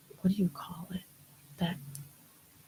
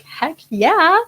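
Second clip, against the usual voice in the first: high, loud delighted yes; excited acceptance; 10 semitones higher, 9 dB louder than usual. Retold or imitated. Performer retelling her yes to friends' invitation, excited and loud. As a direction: leap at the invitation, voice shooting up.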